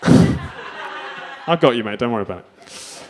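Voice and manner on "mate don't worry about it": ordinary talk, nothing marked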